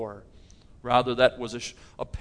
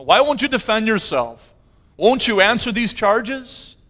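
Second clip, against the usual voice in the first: raised, louder challenge, higher in pitch; insistent questioning; 8 semitones higher, 6 dB louder than usual. Retold or imitated. Governor questions the silent prisoner before him, insistent and raised. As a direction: press the accused for an answer, loud and high.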